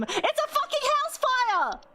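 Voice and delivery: high, slow, overjoyed exclamation; real delighted outburst; excited. Real, not acted. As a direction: shout the answer in triumph. Riddle answer suddenly clicks.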